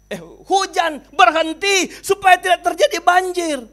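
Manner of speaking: high, loud aggrieved protest; indignant complaint. Acted. Dead old man complaining to God in heaven. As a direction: demand answers, wounded and accusing.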